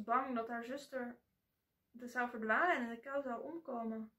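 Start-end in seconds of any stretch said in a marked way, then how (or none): none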